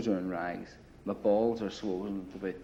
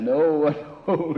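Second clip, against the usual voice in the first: loud, laughing, higher "I know"; laughing agreement; 8 semitones higher, 11 dB louder than usual. Retold or imitated. Prisoner laughing along with the policeman's joke. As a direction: laugh through the words.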